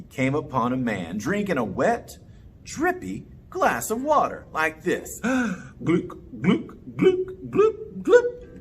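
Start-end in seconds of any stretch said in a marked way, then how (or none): 5.2-8.6 gulping sounds. Acted. Man gulping water, sound effect.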